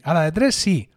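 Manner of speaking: ordinary delivery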